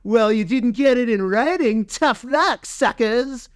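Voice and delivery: mock them like a smug bully; high jeering voice; mocking taunt. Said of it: "being like".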